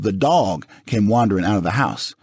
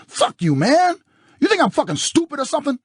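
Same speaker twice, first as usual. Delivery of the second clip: furious shout. A narrator's reading. Husband confronting visitor, furious.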